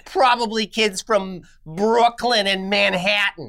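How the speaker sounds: slow, put-on Staten Island accent; hammed-up Staten Island voice; Staten Island woman. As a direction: complain like a suspicious Staten Island local. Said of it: "the woman from Staten Island".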